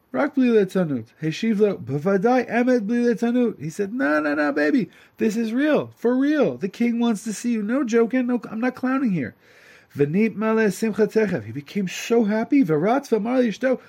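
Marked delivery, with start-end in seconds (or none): 3.9-9.3 much higher, excited buddy voice; assuring messenger. Acted. Messenger assures the simpleton it is real, high and excited. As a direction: swear it's real, grinning.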